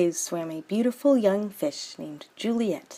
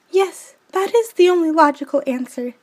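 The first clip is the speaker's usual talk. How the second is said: put-on much higher, louder girlish voice; a sweet young fish princess; Juliet's voice; 10 semitones higher, 7 dB louder than usual. Juliet agrees to elope.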